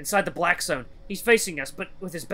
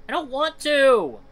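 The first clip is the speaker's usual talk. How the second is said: high, slow whiny refusal; protesting child; 10 semitones higher, 6 dB louder, slower than usual. Acted. Boy refusing the other children's dare, high drawn-out protest. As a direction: wail it out as a reluctant little boy.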